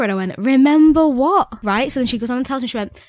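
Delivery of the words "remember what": drawn-out, louder confused retort; baffled. Retold or imitated. Retelling a phone call with Elaine, confused drawn-out question. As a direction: stretch it out like you truly can't recall.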